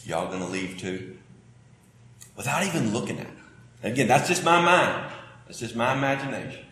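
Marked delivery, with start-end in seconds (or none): 0.0-1.2 quiet, low Southern "Y'all gonna leave too?"; Jesus asks the disciples gently, in a Southern drawl; soft Southern drawl. Retold or imitated. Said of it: "in my Southern way".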